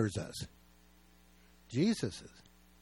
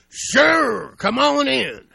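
very loud, high holler imitating a big man; booming welcome; 12 semitones higher, 12 dB louder, much slower than usual. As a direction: bellow the invitation across the room. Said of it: "it was just like that".

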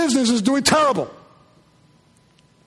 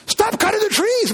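mock protester; 10 semitones higher than usual.

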